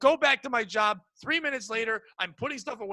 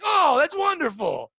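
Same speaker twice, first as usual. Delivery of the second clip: higher, louder, drawn-out mock delight; gushing delight; 5 semitones higher, 5 dB louder, slower than usual. Woman flattered at being guessed younger, mock gushing. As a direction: gush it slowly, as if flattered.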